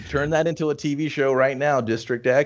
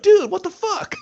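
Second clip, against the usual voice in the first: high, shocked "Dude, what the fuck?"; hammed-up surprise, pitched way up; mock shock. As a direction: blurt it like stunned slackers. Bill and Ted shocked.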